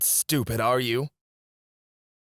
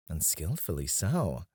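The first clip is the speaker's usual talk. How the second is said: ordinary talk, nothing marked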